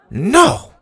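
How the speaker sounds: high, loud flat refusal; sharp no. A narrator's reading. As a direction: snap the refusal. Dora refuses again.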